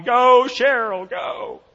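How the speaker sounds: high, loud, drawn-out cheer; crowd cheering. Retold or imitated. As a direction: urge the runner on like a spectator. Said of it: "People are going".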